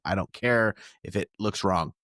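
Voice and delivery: ordinary talk, nothing marked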